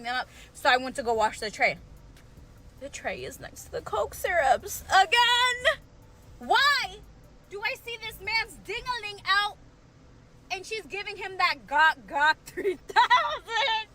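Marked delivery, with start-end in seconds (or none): 2.8-13.9 scandalized retelling; shocked. Retold or imitated. Shocked at second catch.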